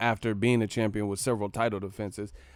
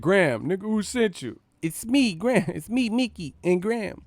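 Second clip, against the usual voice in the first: higher-pitched mock skit voice, acting out both roles; 8 semitones higher, 4 dB louder than usual. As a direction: ham up the skit lines like a rap sketch. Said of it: "that D M X skit".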